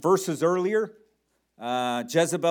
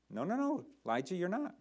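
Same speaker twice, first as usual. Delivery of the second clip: normal talking voice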